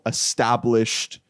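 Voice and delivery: ordinary delivery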